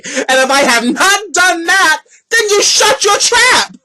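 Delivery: rising to a high scolding snap; slow, pointed, then snap it; shut your trap. Real, not acted. Scolding unwanted advice-givers, a slow building snap.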